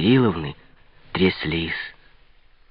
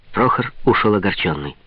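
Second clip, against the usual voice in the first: ordinary delivery, nothing marked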